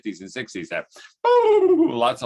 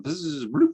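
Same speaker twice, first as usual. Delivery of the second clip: higher buzzing zzz then a rising bloop; cast and splash noise; 7 semitones higher than usual. Retold or imitated. Mentor showing the right cast, buzz and plop. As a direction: mimic the lure whizzing out and landing.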